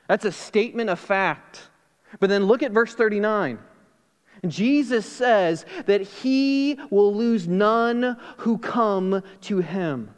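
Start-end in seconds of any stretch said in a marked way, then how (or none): none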